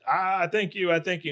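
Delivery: lightly voiced performer taking applause; showman's thanks. Retold bow to the crowd.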